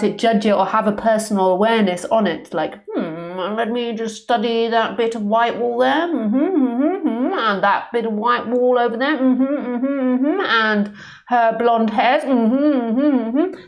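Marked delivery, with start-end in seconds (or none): 2.9-13.6 posh English musing with rising, singsong hums; posh English accent. Acted. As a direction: hum knowingly in a put-on posh English voice. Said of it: "a very posh English accent".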